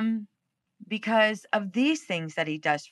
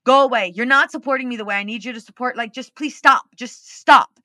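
loud frustrated plea; exasperated shout at husband to stop; retold yell at husband; 2 semitones higher, 8 dB louder than usual. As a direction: push him away, voice raised.

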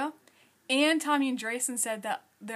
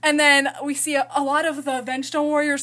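loud rushed voice; 9 dB louder than usual. Real, not acted. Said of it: "change my tone of voice".

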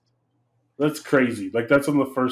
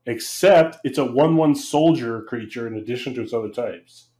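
ordinary talk, nothing marked

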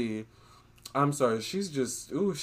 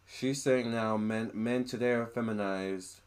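ordinary talk, nothing marked

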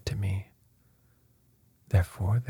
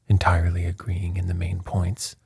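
usual speaking voice

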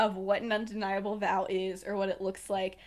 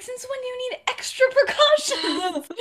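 high, loud mock disbelief breaking into laughter; hammed-up outraged question; incredulous; 7 dB louder than usual. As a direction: squawk the question, half laughing. Acted incredulous challenge in quarrel.

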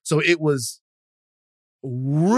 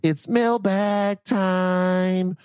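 very slow, drawn-out segment announcer; 3 semitones higher, much slower than usual. Acted. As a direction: stretch it out like a show jingle. Repeated segment intro.

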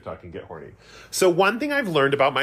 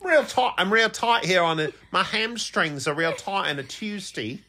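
mock British accent; 7 semitones higher than usual. Keeps mocking the British trainer.

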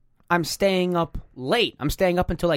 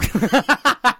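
high, loud laugh; high-pitched loud cackling laugh; burst of laughter; 9 semitones higher, 5 dB louder than usual. Real, not acted. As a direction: crack up loudly at the request. Bursts out after being asked.

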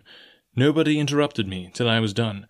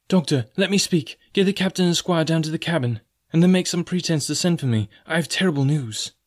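put-on higher-pitched, anxious boy; worried youngster, voice pitched up; urgent plea; 6 semitones higher than usual. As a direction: press the doctor with urgent news. Boy breaks urgent secret news.

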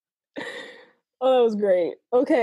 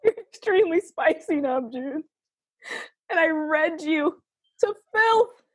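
dramatic reread; 6 semitones higher than usual. Pretend.

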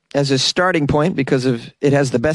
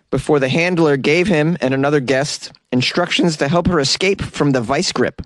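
ordinary talk, nothing marked

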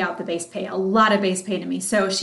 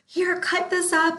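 eager demo; 8 semitones higher, slower than usual. Retold or imitated. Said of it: "I would go nuts".

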